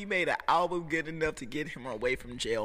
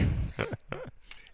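high wheezing laugh. Real, not acted. Laughing at the story.

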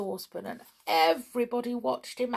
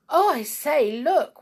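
high, loud exclamation; excited alert; 6 semitones higher, 6 dB louder than usual. A narrator's reading. Sandy spots a cat lost from its broomstick, excited. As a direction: call out in surprise, pitched up.

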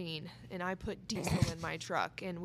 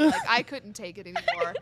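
normal talking voice

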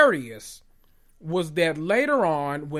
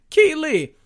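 mock Keely call; 11 semitones higher, 6 dB louder than usual.

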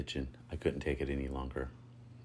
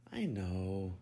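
high, disappointed reply; 6 semitones higher than usual. A narrator's reading. Lucas answering the narrator, disappointed. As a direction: let the disappointment show. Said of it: "no mistaking the disappointment in his voice".

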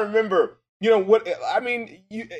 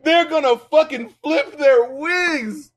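mock smug rapper; 5 semitones higher, slower than usual. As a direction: play the rapper thrilled with his own lame rhyme. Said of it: "did you really think".

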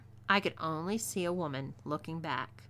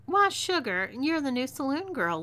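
higher, louder gleeful reveal; gleeful taunting; 6 semitones higher, 5 dB louder than usual. A narrator's reading. Outlaw reveals the captive's saloon job, amused and taunting. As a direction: sing it out with mocking sweetness. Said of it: "laughed Zelia".

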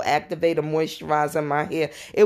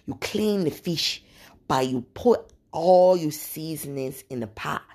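put-on African accent. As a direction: lean in gently with an African lilt. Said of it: "She said".

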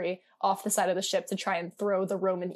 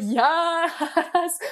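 excited yes; 9 semitones higher, 5 dB louder than usual. Retold or imitated. Excited reading reaction.

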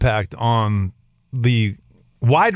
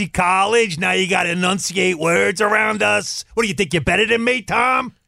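mocking relative; 6 semitones higher than usual. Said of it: "they all go".

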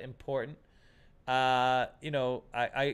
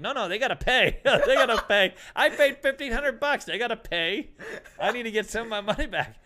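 higher, louder, laughing protest; laughing insistence; 8 semitones higher, 7 dB louder than usual. Retold or imitated. Teller insisting the girls must pay, laughing protest. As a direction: insist while cracking up.

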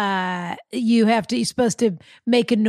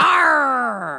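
pirate voice; 3 semitones higher than usual. Pretend. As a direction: growl it like a pirate. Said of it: "It's just a pirate voice".

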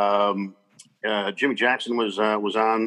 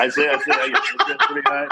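laughing; 4 semitones higher than usual. Real, not acted. Echoing co-host while laughing.

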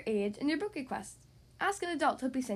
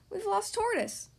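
higher-pitched mild call; 8 semitones higher than usual. A narrator's reading. Friends reporting lost Tortoise, calling out. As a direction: call the bad news ahead. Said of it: "her friends called".

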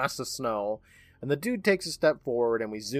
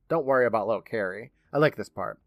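ordinary talk

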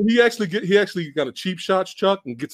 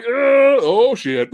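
mock panicked shout; 6 semitones higher, much slower than usual. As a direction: play the guy who sees the foot coming, half-joking. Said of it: "and he's like".

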